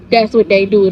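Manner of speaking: ordinary delivery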